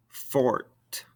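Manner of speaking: "Fort" closed on a released T; sounded final T. Shown as a demonstration. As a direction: let the T of "Fort" be heard. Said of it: "makes us pronounce those ending consonants".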